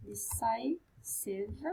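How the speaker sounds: very slow, soft decisive; soft murmur. Real, not acted. Teacher writing at the board, slow soft murmur. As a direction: murmur decisive slowly to herself. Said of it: "teacher mouths word while writing".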